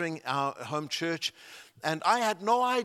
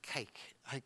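puzzled to self; 3 semitones lower, 15 dB quieter than usual. Retold or imitated. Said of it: "I'm trying to think".

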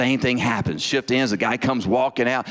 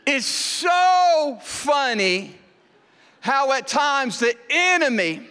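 fervent preaching; 13 semitones higher than usual. Acted. Preacher drawing the moral for his congregation, raised emphatic preaching. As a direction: press the lesson home to the crowd.

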